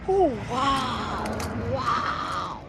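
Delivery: loud delighted laugh and wow. Real, not acted. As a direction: burst out in happy surprise. Delighted discovery in garden.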